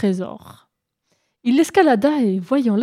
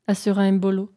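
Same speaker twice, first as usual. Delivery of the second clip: ordinary delivery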